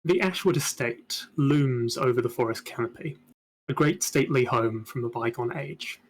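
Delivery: measured scene-setting narration; game master narration. A narrator's reading. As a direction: set the scene like a storyteller.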